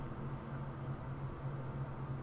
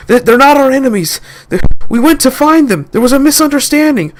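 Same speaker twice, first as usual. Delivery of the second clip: high, loud hurried explanation; urgent pleading; 10 semitones higher, 4 dB louder than usual. Acted. Blake and Derek to the guards: high urgent pleading. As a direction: plead to calm them.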